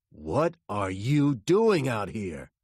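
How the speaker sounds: loud, slow questioning; firm, drawn-out demand; stern demand. A narrator's reading. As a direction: interrogate a suspect at gunpoint. Armed detective questions driver.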